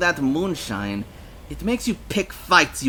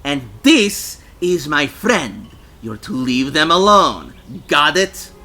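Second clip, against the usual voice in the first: loud, forceful warning; furious; 5 semitones higher, 8 dB louder than usual. A narrator's reading. Lisa defending her friend from drunks, furious. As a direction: lay down the law, loud and higher. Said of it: "She was furious".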